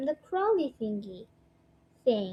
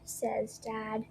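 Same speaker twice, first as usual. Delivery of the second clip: ordinary delivery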